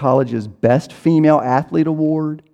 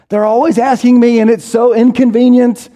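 higher, louder mock whine; a higher pitched, louder sulky grumble; whiny excuse; 9 semitones higher, 5 dB louder than usual. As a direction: play the put-upon complainer, hammed-up. Mock excuse-maker complaining.

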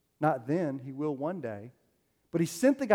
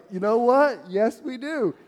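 louder, higher, delighted yes; eager agreement; 8 semitones higher, 8 dB louder than usual. Retold or imitated. Preacher answering his friend at the coffee shop, eager. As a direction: answer with ringing enthusiasm.

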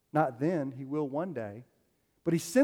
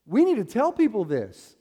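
louder, higher, suddenly eager; excited outburst; 7 semitones higher, 7 dB louder than usual. Retold or imitated. Friend over coffee realising he must share this, excited. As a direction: blurt it out with fresh excitement.

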